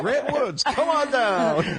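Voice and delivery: high, slow announcer call with a laugh; game show host. Retold or imitated. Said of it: "that's what it sounds like".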